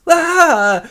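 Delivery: high, loud cry of shock; shocked scream. Acted. Director sees his old lover Linda, high shocked scream. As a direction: scream out in mock horror.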